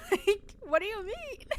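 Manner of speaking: amused, incredulous outburst; laughing disbelief. Retold or imitated. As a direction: laugh through a mock-baffled question. Mock baffled reply amid laughter.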